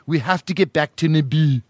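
put-on regal British accent, carefully enunciated; Natalie Portman. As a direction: imitate a formal royal accent.

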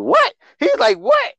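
high squeaky disbelief; shocked what. Retold or imitated. Retelling Sami's shock at the invite, high startled yelp. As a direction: yelp it like you can't believe it.

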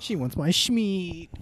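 high, loud, very slow silly brag; goofy boast voice. Pretend. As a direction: ham up a slow cocky boast. Joking boast about waitress.

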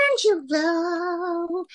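high, drawn-out, cutesy American call; high-pitched American moll, very slow; American gangster's moll. Shown as a demonstration. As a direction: stretch it out like a cute gangster's moll. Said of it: "an American gangster's mole".

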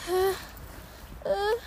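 breathy pre-sneeze gasps; about to sneeze. Real, not acted. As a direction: catch the breath before a sneeze. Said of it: "jetzt muss ich niesen".

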